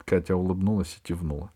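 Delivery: usual speaking voice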